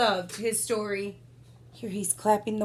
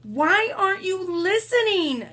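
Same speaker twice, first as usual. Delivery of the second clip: high, somewhat louder demand; shouted; 8 semitones higher, 3 dB louder than usual. Acted. King shouting at Boy who can't hear, mild. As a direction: call out at the boy, exasperated. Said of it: "shouted the king".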